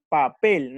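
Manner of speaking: "papel" with the second syllable PEL stressed. Shown as a demonstration. Teacher showing the class where the accent falls, stressing PEL in "papel". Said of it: "Y pel es el acento".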